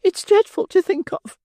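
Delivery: very high, shivering lady's voice; shuddering matron. Acted. Matron shuddering to the beadle at the thought.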